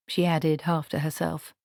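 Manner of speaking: ordinary delivery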